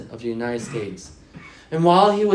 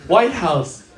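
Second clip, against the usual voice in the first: usual speaking voice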